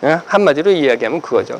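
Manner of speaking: ordinary delivery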